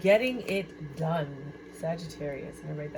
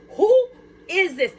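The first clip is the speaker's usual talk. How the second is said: astonished; 12 semitones higher, 7 dB louder, slower than usual. Acted. Astonished at the cards.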